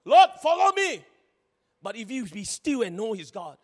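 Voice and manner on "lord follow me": high, loud ordering voice; mock bossy demand. Pastor mocks believers ordering God around, loud demand. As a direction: boss God around like a pushy child. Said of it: "Sometimes we do that to God".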